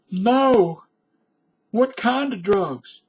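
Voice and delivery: high-pitched goody-goody voice; goody-goody in a skit. Acted. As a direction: play the timid rule follower, pitched high. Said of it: "let's put on a little skit".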